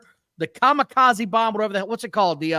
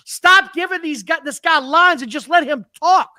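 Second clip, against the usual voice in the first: loud, high exasperated rant; mini meltdown; 6 semitones higher, 5 dB louder than usual. Real, not acted. Co-host fed up with scripted wrestling promos, exasperated rant. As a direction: rant with rising frustration, pitched up. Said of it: "having a little meltdown".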